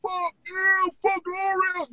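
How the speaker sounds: high, lightly mock New York heckler; heckling fan. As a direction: jeer like a rival fan. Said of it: "You're like".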